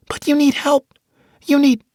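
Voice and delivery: put-on high, loud girl's plea; pleading young girl. Tasha begging Mitch to accept help, high pleading. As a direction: plead like a frightened kid.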